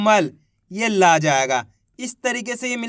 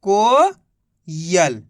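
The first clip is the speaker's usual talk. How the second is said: Coil stretched very slowly, syllables joined into one word; slowly blending coil for young learners; drawn-out blend; 4 semitones lower, much slower than usual. Shown as a demonstration. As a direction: draw out coil slowly so the sounds merge. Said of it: "तो अपने मिलके बन गया ये".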